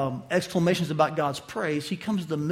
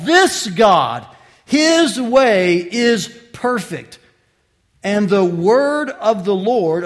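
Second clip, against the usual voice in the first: loud, rising proclamation of the psalm; preaching at full force; 3 semitones higher, 8 dB louder than usual. Acted. Preacher proclaiming the psalm to his congregation, loud and fervent. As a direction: thunder the verses out to the church.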